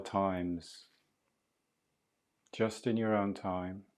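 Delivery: calm, unhurried meditation guide voice; soothing breathwork guide talking a student through the breath; guided breathing meditation. Acted. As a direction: ease the listener through each breath, gently.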